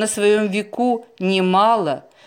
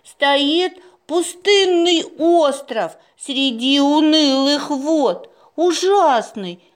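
high, slow, solemn recital; reading an inscription aloud; 6 semitones higher, slower than usual. A narrator's reading. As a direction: intone it like an ominous verse. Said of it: "Маруся вслух прочитала надпись".

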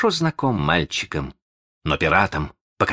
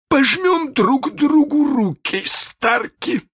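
high, slow, mocking captain; cruel captain; 3 dB louder, slower than usual. Hook offers Starkey his iron hand, high and mocking.

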